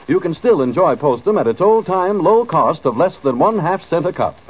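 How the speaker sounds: upbeat vintage ad read; old radio announcer. Acted. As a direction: pitch the product cheerfully. Announcer reads the Postum ad.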